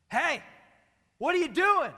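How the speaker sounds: sharp high yell from behind. Retold or imitated. Woman at Walmart yells at him from behind. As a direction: call out loud at a stranger blocking you.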